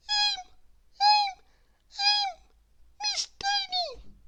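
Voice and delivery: very high, squeaky, stammering and slow; tiny stammer. Acted. Miss Tiny answering Mister Strong, squeaky and stammering. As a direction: stutter it out, scared and tiny. Frightened tiny mouse-sized lady.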